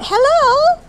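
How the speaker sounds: squeaky high voice for the dog, up in pitch and loud. Pretend. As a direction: speak for the dog, very high and bright. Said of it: "She's like".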